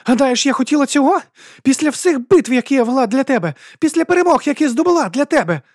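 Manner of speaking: very high, loud, enraged protest; almost shriek it in outrage; near-screaming fury. A narrator's reading. Woman reproaches the duke she fought for, nearly screaming. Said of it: "Вона ледь не верещала з люті".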